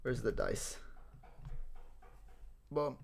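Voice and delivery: normal talking voice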